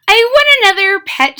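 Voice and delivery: a quick demand from a small witch; little girl. Acted. Mitzi back at the shop asking for a new pet: high, quick girl voice. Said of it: "she said".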